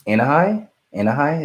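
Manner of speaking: "Anahi" with a high rising question tune; "Anahi" lifted high, checking it's right; unsure pronunciation. Real, not acted. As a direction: try "Anahi" tentatively, pitch climbing to the end. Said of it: "if I say it incorrectly".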